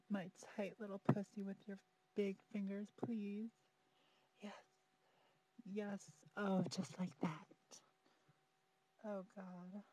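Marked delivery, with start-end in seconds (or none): none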